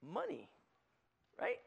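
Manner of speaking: ordinary talk, nothing marked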